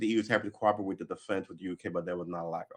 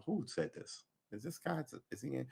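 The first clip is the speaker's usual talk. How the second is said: much softer, higher, half under the breath; puzzling it out quietly while searching; muttered to self; 5 semitones higher, 10 dB quieter than usual. Real, not acted. Muttering while scrolling the article.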